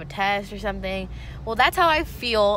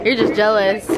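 laughing tease; 10 dB louder than usual. Real, not acted. Teasing, laughing.

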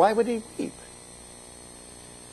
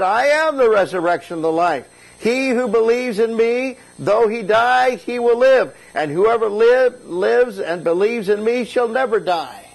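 ringing, raised-pitch preacher's proclamation; emphatic declaration; 7 semitones higher, 4 dB louder than usual. Acted. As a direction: proclaim it high and strong. Preacher voices Christ's emphatic declaration.

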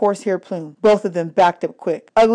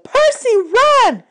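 very high, loud warning cry; urgent yell; 6 dB louder, much slower than usual. Acted. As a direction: scream the warning at him. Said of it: "who yelled".

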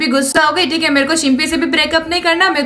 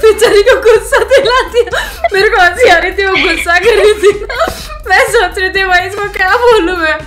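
laughing; 4 semitones higher than usual. Real, not acted. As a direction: laugh it out, can't hold it. Cracking up after the prank.

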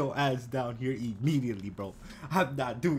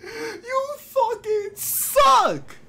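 high, slow, drawn-out screaming; long anguished yell of rage; 8 dB louder, much slower than usual. Real, not acted. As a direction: scream it at the screen in fury. Raging at game loss.

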